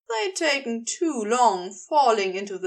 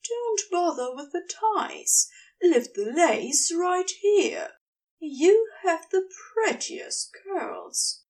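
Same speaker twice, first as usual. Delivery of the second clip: Gabriel directing; 6 semitones higher than usual. Acted. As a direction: guide her step by step, lingering.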